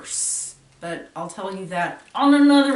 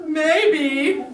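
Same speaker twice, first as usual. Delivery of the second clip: high, louder playful rising maybe; a coy, higher teasing maybe; 7 semitones higher, 6 dB louder than usual. Real, not acted. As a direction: dangle the idea mischievously. Teasing about luring the ghost.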